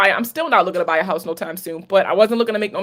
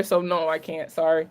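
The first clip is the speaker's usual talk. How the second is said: usual speaking voice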